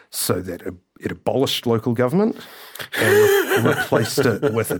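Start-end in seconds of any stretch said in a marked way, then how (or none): 3.0-4.8 laughing. Real, not acted. Laughs at own joke.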